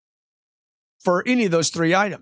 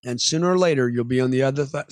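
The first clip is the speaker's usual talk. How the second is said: normal talking voice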